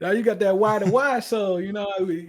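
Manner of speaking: loud, high, laughing delight; excited, laughing hype; real laughing excitement. Real, not acted. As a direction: burst out talking through a laugh. Laughing excitedly at the show idea.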